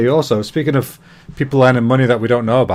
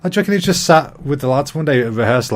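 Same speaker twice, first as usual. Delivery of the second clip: normal talking voice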